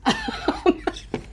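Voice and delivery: high breathy giggle; a quick airy giggle; high laugh. Real, not acted. Wasted thirty seconds, a high breathy giggle.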